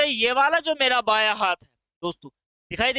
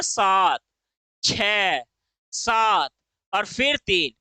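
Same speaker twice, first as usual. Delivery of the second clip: सात, छह, सात, तीन each alone with a pause after it; digit by digit; 4 semitones lower, much slower than usual. Shown as a demonstration. Teacher shows how digits after the point are read, one at a time. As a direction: separate सात, छह, सात, तीन as single numbers. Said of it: "इसको पढ़ोगे कैसे?".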